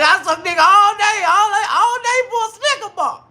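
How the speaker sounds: high, loud hammed-up wail; mock wailing. Old lady sobbing over the Snicker bar. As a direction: cry it out like a broken old lady.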